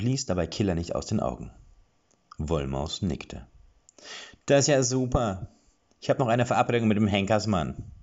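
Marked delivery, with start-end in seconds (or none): 4.5-7.9 higher, louder gleeful dwarf; delighted about his appointment; cheerful dwarf. A narrator's reading. Dwarf happily mentions meeting the executioner, high and loud. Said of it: "sagte er vergnügt".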